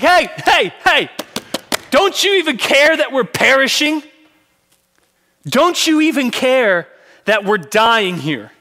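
high, loud, frantic pleading; desperate cries over the storm; panicked disciples. Acted. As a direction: shake him awake in terror. Disciples wake Jesus in storm.

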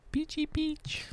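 very high, soft sing-out; playful squeak. Pretend. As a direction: say it small and way up high. Playful repeat of the flavour.

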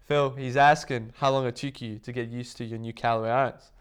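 loud acted shout back; imitated caddy calling out. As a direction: relay the question loudly across the range. Caddy calls back to Phil.

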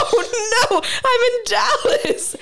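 high, slow, laughing whisper. Retold or imitated. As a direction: giggle it out under breath, tipsy. Drunk realization, laughing.

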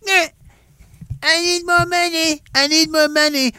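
high, put-on greedy whine; whining government. Government begging taxpayers for money, high mock whine. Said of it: "There's the government".

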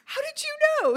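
very high, loud and gleeful; thrilled he guessed it; delighted amazement. Retold or imitated. Answering the manager on the phone, high gleeful amazement.

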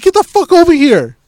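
high, loud holler; retold angry yell. Owner calling his stray dog over, yelling angrily. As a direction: yell a command at a disobedient dog.

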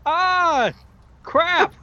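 high goblin yelp of dismay. Acted. Illusion goblin drops the dinner with a high yelp. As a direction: squeal it like you just dropped everything. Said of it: "make the sound of a clatter".

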